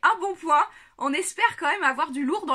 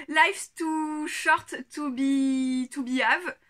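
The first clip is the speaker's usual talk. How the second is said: very slow, heavily French-accented English; bad French accent in English; much slower than usual. Shown as a demonstration. Reading the card aloud in clumsy French-accented English. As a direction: spell out the English slowly with a strong French accent. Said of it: "mon accent anglais est toujours aussi nul".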